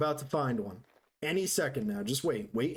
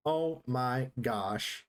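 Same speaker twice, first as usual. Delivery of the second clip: very slow, word by word; deliberate, spaced-out disbelief; slow, emphatic exclamation; 4 semitones lower, much slower than usual. Real, not acted. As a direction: draw out each word with a pause between, low. Repeats it slowly for emphasis.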